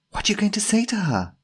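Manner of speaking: high, quick companion's voice; urgent questioning. Acted. Jemima asks Timothy about meeting Tanya, high urgent question. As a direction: press the question fast and high.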